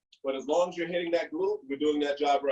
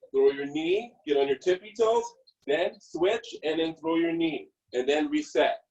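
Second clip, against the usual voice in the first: normal talking voice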